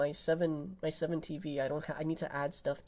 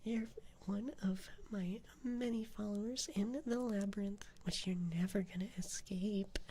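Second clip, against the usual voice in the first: put-on soft teasing Valley Girl whisper; Valley Girl accent; 3 dB quieter than usual. Thanking a follower in a teasing Valley Girl whisper. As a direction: purr it like a smug Valley Girl. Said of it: "Valley Girl Gato accent".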